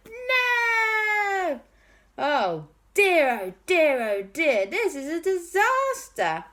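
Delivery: put-on high, fretful child voices; dismayed boys. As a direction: wring your hands over the disaster. Boys see Hollywood destroyed.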